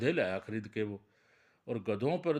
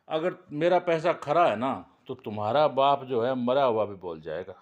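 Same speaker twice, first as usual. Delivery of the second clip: louder, challenging vow; loud insistence; 8 dB louder than usual. Acted. Man challenges the sons about their father, loud and defiant. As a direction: declare it loudly so all hear.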